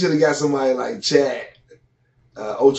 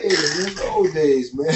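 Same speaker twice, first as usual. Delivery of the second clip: normal talking voice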